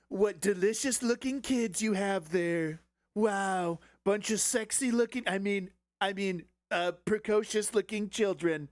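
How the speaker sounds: put-on ogle the kids like a creep; creepy host voice. Mocking Chinese hosts greeting kids.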